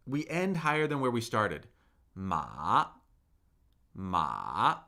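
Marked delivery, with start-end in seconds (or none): 2.1-4.8 low falling-rising "ma", said twice; third tone. Shown as a demonstration. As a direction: dip "ma" down and swing it up. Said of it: "falling and rising".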